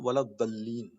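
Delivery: ولا الضالین with a short da, daad as d; without madd. Shown as a demonstration. Teacher shows it without madd, short vowel in ولا الضالین. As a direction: say ولا الضالین unstretched, wa-lad-dal-leen. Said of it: "اگر یہ مدہ زبر نہ ہوتا".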